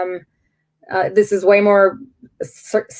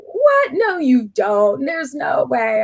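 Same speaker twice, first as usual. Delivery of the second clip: shocked disbelief; 5 semitones higher, slower than usual. Retold or imitated. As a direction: cry out in disbelief, then refuse it. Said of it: "he's like".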